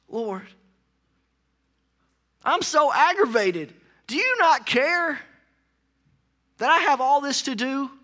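a hint of an exasperated Martha; mildly whiny complaint to Jesus; aggravated Martha. Martha complaining to Jesus about her sister, lightly exasperated.

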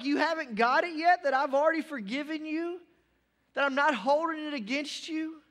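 high, loud pleading disbelief; tearful Joseph. Acted. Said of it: "he's just like there, like".

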